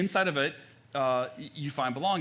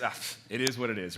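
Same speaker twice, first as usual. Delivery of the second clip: dismissive shrug; 4 semitones lower than usual. Retold or imitated.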